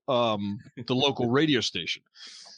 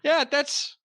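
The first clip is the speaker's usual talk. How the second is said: sarcastic cabbie; 9 semitones higher, slower than usual. Retold or imitated. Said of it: "and he goes".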